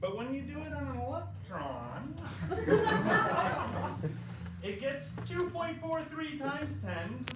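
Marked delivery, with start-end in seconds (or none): 2.2-4.6 quick giggle burst; sudden laugh; burst of laughter. Real, not acted. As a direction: crack up briefly. Laughs after electron line.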